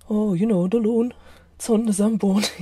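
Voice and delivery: mock Canadian accent with a Welsh tinge; bad Canadian accent. Shown as a demonstration. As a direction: put on a silly Canadian accent that slips Welsh. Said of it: "you're getting Canadian and a Welsh accent".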